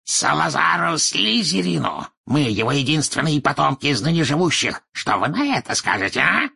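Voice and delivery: high-pitched, proud proclamation; boast, then challenge for an answer; haughty cry with a sharp rising end; shouted out. A narrator's reading. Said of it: "выкрикнул Мракс".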